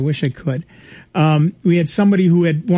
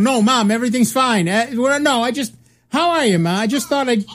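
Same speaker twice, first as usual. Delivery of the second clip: higher-pitched, mock-sheepish excuses; flustered kid on phone; 5 semitones higher than usual. Students answer mom's accidental call, flustered. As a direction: stumble through reassuring a parent, higher. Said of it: "kids would suddenly be answering the phone".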